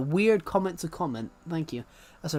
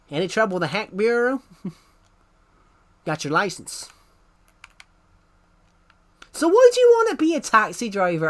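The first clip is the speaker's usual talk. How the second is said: Texan accent, sliding higher and louder by the last question; 6 semitones higher, 6 dB louder than usual. Shown as a demonstration. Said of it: "in a Texan accent".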